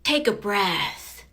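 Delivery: slow, patronizing take a breath; slow condescending. Retold or imitated. [name] imitates the guy who cut her off, slow and condescending. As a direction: drawl it out like talking down to her. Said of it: "he cuts me off, and he's like".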